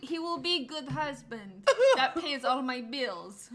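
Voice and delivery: bad mock Russian accent; hammy Russian accent, laughing. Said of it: "Russian accents are great".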